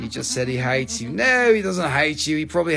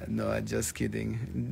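ordinary talk

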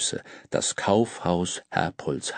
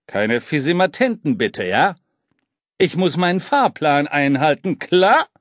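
strenger Schaffner; 5 semitones higher, 4 dB louder than usual. A narrator's reading.

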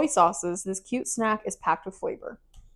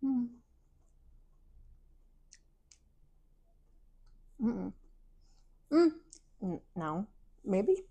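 humming while chewing; talking through a mouthful; mouth full chewing; 8 dB quieter, slower than usual. Real, not acted. Seen 2: bites the bar at -7, then chews with closed mouth throughout.

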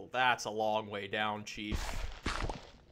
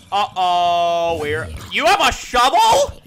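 much higher, loud startled questioning; alarmed; 9 semitones higher, 16 dB louder than usual. Real, not acted. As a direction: caught off guard by an armed mob. Mob with a shovel appears.

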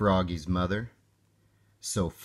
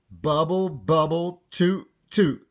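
higher little-frog echo, lightly spluttered; spluttering; 5 semitones higher, 3 dB louder than usual. A narrator's reading. Froggy repeating after his mother, high light splutter. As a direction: parrot it back in a high kid voice. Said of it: "spluttered Froggy".

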